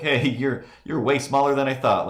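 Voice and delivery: cooing at a dog. Retold or imitated. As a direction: tease the little dog fondly.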